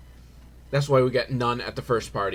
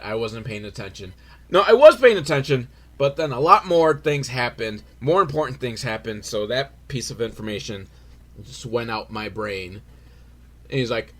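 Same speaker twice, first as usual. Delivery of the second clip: ordinary talk, nothing marked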